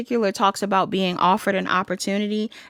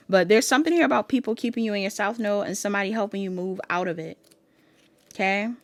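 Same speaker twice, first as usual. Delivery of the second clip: normal talking voice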